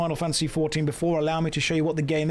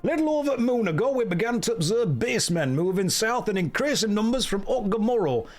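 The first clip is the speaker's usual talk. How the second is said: lively, higher-pitched Yorkshire commander; Yorkshire accent; 5 semitones higher than usual. Acted. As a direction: read it out in a put-on Yorkshire accent. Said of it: "I gave you a Yorkshire accent".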